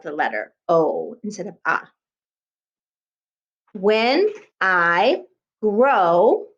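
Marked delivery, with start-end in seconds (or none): 3.7-6.5 very slow, each word stretched; slow dictation. Shown as a demonstration. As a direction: dictate it one word at a time. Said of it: "You write it".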